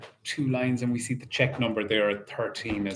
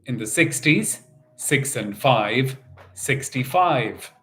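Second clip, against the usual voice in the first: bingo caller; 4 semitones higher than usual. Acted. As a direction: call the numbers like a bingo hall host. Calling bingo numbers in rhythm.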